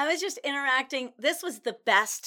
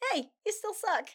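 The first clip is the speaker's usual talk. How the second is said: sugary sweet; 6 semitones higher, 4 dB quieter than usual. Retold or imitated. Mock sweet insult.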